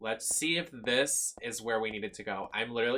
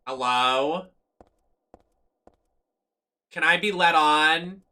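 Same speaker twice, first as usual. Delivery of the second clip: loud, higher calls with a rising end; calling out; 6 semitones higher, 9 dB louder, slower than usual. Real, not acted. Gamer stuck at the train, calling out loud. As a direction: call across the platform for someone.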